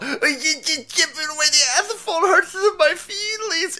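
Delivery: very high squealing crybaby voice; mock crybaby. Host mimicking the protester being chipped free, mock crying. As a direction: wail it in a hammed-up falsetto. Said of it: "He cries like a bitch".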